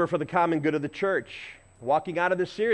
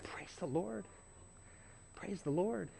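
much softer, breathy-free hushed praise; 3 semitones higher, 16 dB quieter than usual. Retold or imitated. Preacher quietly answering the cleaning lady, much softer. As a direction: murmur it in awe. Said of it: "And I said".

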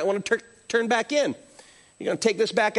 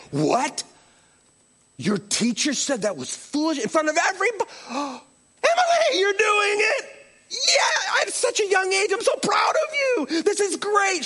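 overjoyed proud dad; 11 semitones higher than usual. Retold or imitated. Dad praising daughter Emily for being mocked, joyful shouting. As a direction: yell it like the best news ever. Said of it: "instead I'm like".

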